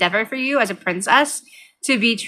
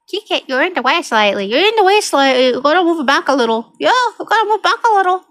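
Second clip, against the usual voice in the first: sing-songy nagging, rising higher; cutesy pet talk; 7 semitones higher, 4 dB louder than usual. Real, not acted. Owner asking her cat to move back, cutesy. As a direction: coax the cat, getting higher as you repeat it.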